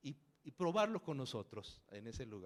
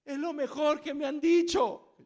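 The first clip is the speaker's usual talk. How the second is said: high, loud, overjoyed answer; fervent praise, imitated; 12 semitones higher, 14 dB louder than usual. Woman answers whether she liked it, high loud fervour. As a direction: belt out heartfelt enthusiasm.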